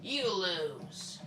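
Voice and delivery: put-on devil voice; gloating devil. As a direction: rub it in.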